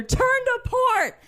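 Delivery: retold shouted command to port; shouted order. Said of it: "I shout over my shoulder".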